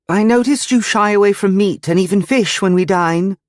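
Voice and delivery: put-on high, eager girlish voice; a devoted girl, keen to please; eager young girl voice. A narrator's reading.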